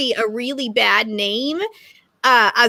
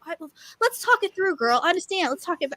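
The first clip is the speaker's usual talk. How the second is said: quick, soft, higher, coaxing; soothing girlfriend voice; 4 semitones higher, 6 dB quieter, faster than usual. Retold or imitated. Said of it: "for me, she was like".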